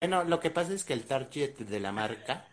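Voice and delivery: un toque de publicista pretencioso, más agudo; burlándose de publicista. Retold or imitated. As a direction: parodia ligera de la agencia. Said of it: "no digas". (imitación ligera de publicista)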